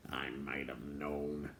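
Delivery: low, soft, slow grumble; dwarf sighing at an old acquaintance, slow and low; grumpy dwarf. A narrator's reading. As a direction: grumble it out slowly. Dwarf's resigned remark.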